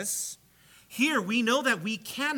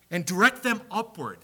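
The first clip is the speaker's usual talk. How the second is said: normal talking voice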